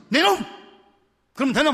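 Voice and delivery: loud, high scolding bark; mock scolding. Pastor showing how people train others by scolding, loud telling-off. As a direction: tell them off sharply at the top of the voice. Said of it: "야단으로 단련하는 거지".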